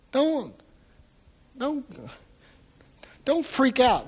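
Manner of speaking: soft, calming reassurance. Retold or imitated. Elisha calming his frightened servant, soft reassurance. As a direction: settle him down gently. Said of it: "Elisha stops and he says".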